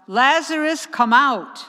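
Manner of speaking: loud commanding call; crying out. Acted. Jesus at the tomb calling Lazarus out, loud command. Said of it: "and then he cried out".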